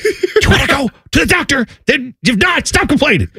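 high, strained scolding; retold fed-up dad voice; exasperated parent.

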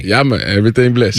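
low, relaxed Jamaican patois; Caribbean patois. Shown as a demonstration. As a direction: coach someone in laid-back island patois. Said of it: "the way you're saying it".

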